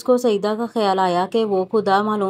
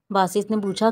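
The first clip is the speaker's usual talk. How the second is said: plain reading voice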